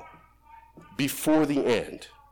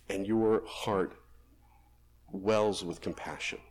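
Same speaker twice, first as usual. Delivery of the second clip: prayer; 5 semitones lower than usual. Acted. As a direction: pray it gently with long pauses. Closing prayer.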